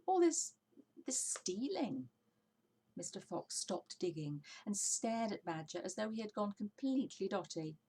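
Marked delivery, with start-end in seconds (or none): 0.1-2.1 high then slowing, disbelieving; as if the question is absurd; incredulous. Acted. Mister Fox echoing Badger, incredulous.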